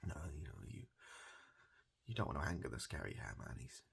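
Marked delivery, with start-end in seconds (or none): none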